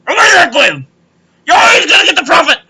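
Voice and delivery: telling Graham the lesson, yelling; heated shout. Real, not acted. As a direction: shout it at him.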